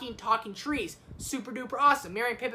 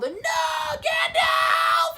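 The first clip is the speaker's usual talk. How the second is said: high, drawn-out dramatic scream; anguished yell; 6 dB louder, much slower than usual. Acted. Frodo crying out as Gandalf falls, long acted yell. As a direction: yell it long, as if losing him.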